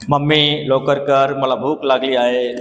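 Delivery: slow gentle ask to mummy; slow polite ask. Shown as a demonstration. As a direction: ask gently and slowly, no hurry. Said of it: "असं तर कधी बोलणार नाही तुम्ही".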